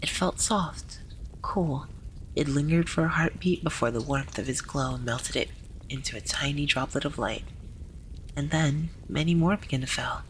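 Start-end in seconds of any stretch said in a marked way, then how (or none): none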